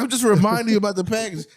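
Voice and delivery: high, quick defensive protest; rapid high-pitched reminder. Retold or imitated. Retelling quarrel with partner.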